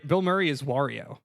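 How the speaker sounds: normal talking voice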